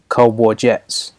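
usual speaking voice

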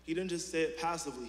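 ordinary talk

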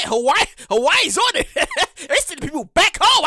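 chuckling through the words; laughing. Real, not acted. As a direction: laugh while telling it. Laughing at the news.

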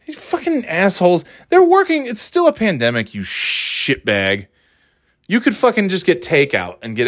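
high, indignant scolding that bursts out; angry outburst. Retold or imitated. Telling off a customer who stiffed the server, angry outburst. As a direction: lash out high, then land hard on the insult.

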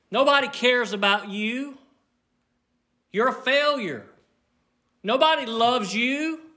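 high, loud taunting accuser; loud, high devil's taunts. Acted. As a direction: sneer it like the enemy mocking. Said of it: "He speaks loudly".

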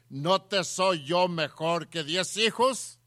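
loud, slow, emphatic rhetorical question; insistent husband. Acted. Preacher voicing the husband to his sad wife, insistent. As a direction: hammer out the husband's challenge word by word. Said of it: "Y luego le dice".

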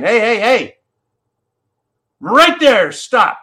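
loud, high cry of hey hey hey, then a firm stop; retold shout. People warning the government off, shouted. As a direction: call out to halt someone mid-step.

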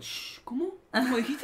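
high, startled, breaking into laughter; a startled gasp that cracks into a laugh; shocked interviewer. Acted. Acted interviewer reacting, high pitch.

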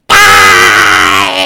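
deep, loud growled scream; metal scream. Pretend. Singer ends the verse with a loud growled scream. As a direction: roar it out like a death metal singer. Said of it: "I wanna see you sing this one song".

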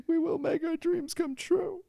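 very high, slow, squeaky voice; squeaky falsetto flourish. Pretend. Podcaster closing his mock speech with a squeaky falsetto.

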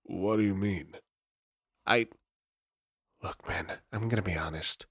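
low, soft, conspiratorial vampire; secretive Nosferatu dealer. Acted. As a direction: talk him down like a shady dealer. GM voices Nosferatu Lane.

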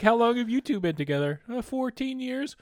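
high, bright nervous questions; mock awkward crowd work. Imagined comic quizzing a couple, high nervous mock chirp. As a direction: chirp then freeze awkwardly.

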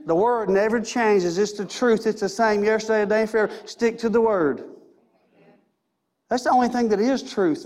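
usual speaking voice

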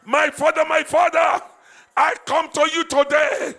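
loud, driving prayer leader; preaching at full force. Acted. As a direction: urge the church to cry out to God. Leads congregation in loud prayer.

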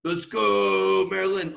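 high, loud courtside cheer; full-voiced chant from the stands; yelling cheer. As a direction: yell it down at the team like a fan. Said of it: "I'm yelling down".

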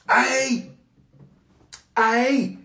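high, punchy comic confession; comic punchline. Acted. Preacher repeats the punchline to the congregation, high and comic. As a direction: land it like a guilty joke.

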